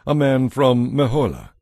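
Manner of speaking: ordinary delivery, nothing marked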